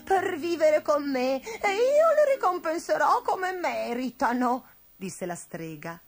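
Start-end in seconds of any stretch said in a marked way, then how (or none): 0.0-4.6 emotional, raised witch voice; grateful old witch. A narrator's reading. Witch praising her returned friends, moved. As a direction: declare it high and full of feeling.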